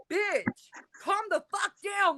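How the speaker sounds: high-pitched, exasperated retelling of an order; retold scolding, not shouted; exasperated retort. Retells telling ex to calm down.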